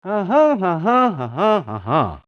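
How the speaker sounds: "Uh-huh" repeated in a singsong, stepping down from high; Dakota accent tune. Shown as a demonstration. Demonstrating the meadowlark's accent, melodic "uh-huh". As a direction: lilt each "uh-huh" like a meadowlark. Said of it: "There's the Dakota accent".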